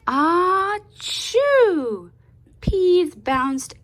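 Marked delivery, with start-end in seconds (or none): 0.1-2.1 loud high acted sneeze; big sneeze. Storyteller acting out Louise's sneeze, loud. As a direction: throw out a huge sneeze.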